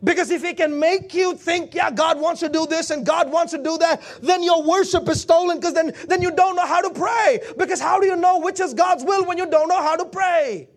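high, urgent pulpit delivery; relentless, high-pitched preaching run; full-force preaching. Acted. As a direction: drive it home like a preacher.

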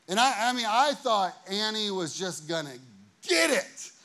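normal talking voice

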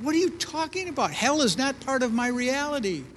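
high, quick brush-off; dismissive hippie. Retold or imitated. Husband brushing off wife's fear of hell, scoffing. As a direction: scoff it away, fast and high. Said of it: "I said".

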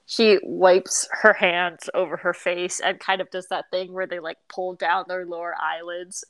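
ordinary talk

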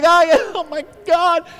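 high, loud mock wail of a woman giving birth; hammed-up, high-pitched cry of pain; imitated labour outcry. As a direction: mimic her crying out in labour. Voicing wife during car delivery.

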